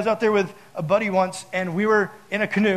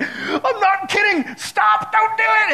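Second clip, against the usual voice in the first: very high, loud panicked pleading; freaking out; 6 dB louder, slower than usual. Retold or imitated. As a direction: act out a hammed-up squealing plea to stop. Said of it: "I'm, like, freaking out".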